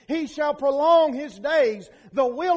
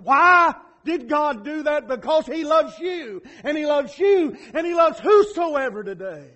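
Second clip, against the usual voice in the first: loud, high, rising sermon build; preaching at full force; 3 semitones higher, 7 dB louder than usual. Acted. Pastor building to the cross before the congregation, fervent loud preaching. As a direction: drive it home to the congregation.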